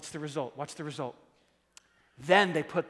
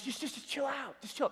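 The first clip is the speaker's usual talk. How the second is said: calm reassurance; 3 semitones higher, 10 dB quieter than usual. Retold or imitated. God speaking calmly.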